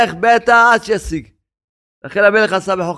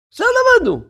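high mock refrain; faster than usual. Preacher mocking the idea, high mock voice. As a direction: leap very high on עכשיו למדנו.

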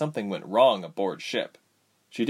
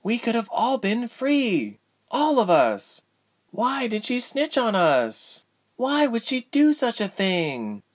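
high, drawn-out anguished protest; upset prisoner; 14 semitones higher than usual. Acted. Betrayed prisoner to cellmate, high wailing disbelief.

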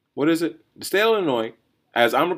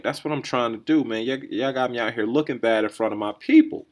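usual speaking voice

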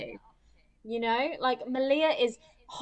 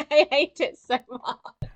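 laughing through a slow complaint; half laughing, half groaning it out; real laugh while admitting hate; 3 semitones higher, 3 dB louder, slower than usual. Real, not acted.

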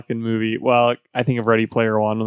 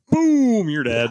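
mock superhero boom; 7 semitones higher, much slower than usual. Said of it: "I would just be like".

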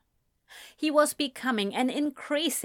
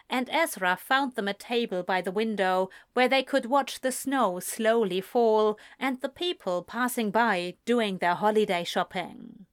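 ordinary delivery, nothing marked

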